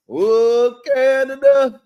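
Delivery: a hammed-up Canadian accent; mock Canadian accent. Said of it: "That's how they say, right?".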